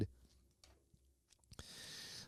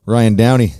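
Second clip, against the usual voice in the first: louder announcer call of the name; hype announcer; 4 semitones higher, 8 dB louder than usual. Acted. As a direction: belt the guest's name like a ring announcer. Hyping up his guest's name.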